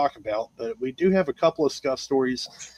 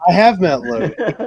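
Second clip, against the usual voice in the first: laughing; 5 semitones higher, 7 dB louder than usual. Real, not acted. Amused reply about Logan.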